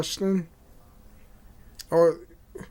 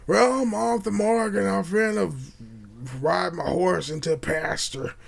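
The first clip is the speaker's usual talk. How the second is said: Arthur Morgan. Retold or imitated. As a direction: put on a game cowboy's voice, lightly. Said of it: "you know how his voice is".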